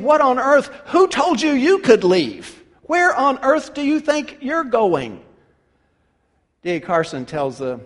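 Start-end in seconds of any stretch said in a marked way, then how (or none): 0.0-5.2 higher and louder, indignant; indignant demand. Retold or imitated. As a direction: demand it like he's been betrayed. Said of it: "He's saying".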